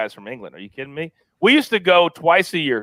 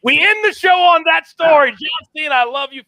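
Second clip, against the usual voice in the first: high, loud excited call-out; excited shout; 12 semitones higher, 4 dB louder than usual. Real, not acted. Host closes the show on his wild story, shouting with excitement.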